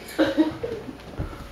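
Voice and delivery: airy high giggle; breathy giggle; real laughter. Real, not acted. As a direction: crack up breathily. Breaks up mid reading.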